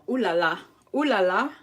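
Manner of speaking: loud, sing-song mock-French ooh la la; playful ooh la la. Host hams up French phrases for her live viewers, loud and playful. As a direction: toss it out like a cartoon Parisian.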